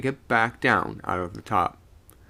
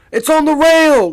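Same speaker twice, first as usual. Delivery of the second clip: loud, much higher frightened cry; cried out; 15 dB louder than usual. A narrator's reading. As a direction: yell in alarm. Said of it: "cried several voices".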